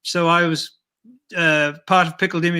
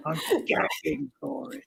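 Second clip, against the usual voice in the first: soft, unvoiced eager aside; whisper; 7 semitones higher, 9 dB quieter than usual. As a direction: breathe it out greedily. Puppet's breathy aside.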